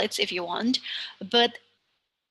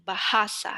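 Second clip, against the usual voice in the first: bahasa with HA, the middle syllable, stressed. Shown as a demonstration. Teacher shows students where stress falls in bahasa, middle syllable stressed. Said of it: "the penultimate syllable is the middle one".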